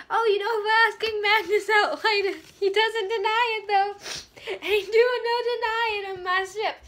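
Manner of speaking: high, loud and sing-song teasing; hammed-up gossiping sister; mock sassy sister. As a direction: tease him gleefully about Magnus. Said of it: "she's like".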